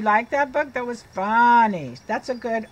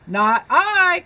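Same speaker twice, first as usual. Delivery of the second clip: higher, louder, curt refusal; duck's refusal; 6 semitones higher, 6 dB louder than usual. Acted. Duck refuses to help the hen, curt and loud. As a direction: snub the hen like a busy duck. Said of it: "quacked the duck".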